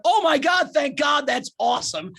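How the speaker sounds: high, gushing retold relief; mock elation. Comic acting out relief at buddy's breakup news, mock elation. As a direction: exclaim it with overjoyed, hammed-up relief.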